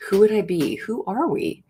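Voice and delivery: usual speaking voice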